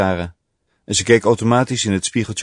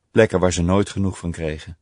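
ordinary delivery, nothing marked

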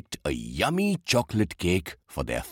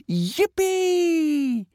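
very high, drawn-out squeal; joyful cheer. A narrator's reading. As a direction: cheer like a delighted child. Child cheers at cake promise.

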